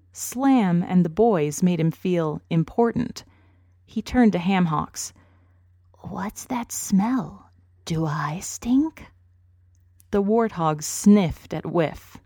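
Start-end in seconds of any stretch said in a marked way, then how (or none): 6.0-9.1 slow, half-whispered skunk question; a nervous skunk checking himself; slow hushed skunk worry; worried skunk. A narrator's reading.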